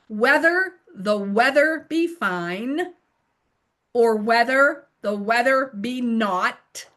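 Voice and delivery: "whether the weather" slow, with pauses and crisp consonants; enunciated tongue twister. Shown as a demonstration. Tutor helping learners through a tongue twister, enunciated. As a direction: spell out "whether the weather" carefully, rising on each phrase. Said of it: "You're enunciating and helping them".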